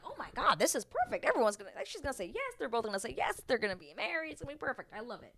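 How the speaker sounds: high, gushing anticipation; excited certainty. Retold or imitated. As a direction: retell giddy hope, lilting upward. Retelling her certainty they'd marry.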